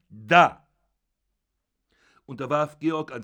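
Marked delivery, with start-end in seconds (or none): 0.1-0.7 an explosive, very loud bark; sudden shout. Acted. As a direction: hurl the word with the paper. Father throws the newspaper. (plötzlicher, sehr lauter Ausruf)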